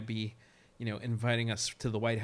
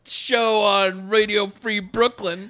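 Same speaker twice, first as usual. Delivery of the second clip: hyped announcer; 3 semitones higher, 7 dB louder than usual. Pretend. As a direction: pump up the show name. Hyped show intro.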